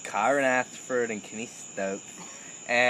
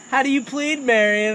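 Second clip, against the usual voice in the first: mock judge; 10 semitones higher, 4 dB louder than usual. Judge asks in court.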